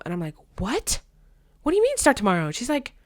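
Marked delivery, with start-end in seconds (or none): 0.6-2.5 high, louder incredulous what; shocked disbelief. Retold or imitated. Teen stunned by auntie's advice, high shocked voice. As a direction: squeak out the disbelief, pitched up.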